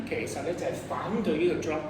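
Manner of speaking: ordinary talk, nothing marked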